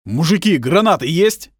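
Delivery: loud, high bark; barked question. Acted. Shubin barking to the men for grenades. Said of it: "гаркнул Шубин".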